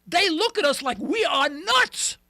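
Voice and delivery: slow, loud, high exclamation; slow shout. Real, not acted. Host indignant at how Russia sees America, slow shout. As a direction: shout each word out slowly. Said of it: "host ranting".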